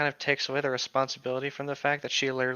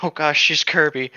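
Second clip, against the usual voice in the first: amused louder outburst; loud laughing exclamation; 9 dB louder than usual. Real, not acted. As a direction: blurt it out loud and delighted. Laughing reaction to fan fiction.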